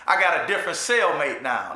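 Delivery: normal talking voice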